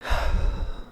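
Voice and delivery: a long tired exhale to catch breath; a breathless sigh before going on; weary sigh. Acted. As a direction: breathe out, spent. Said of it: "let me catch my breath".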